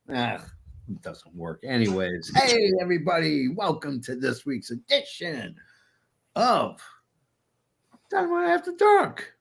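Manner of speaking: usual speaking voice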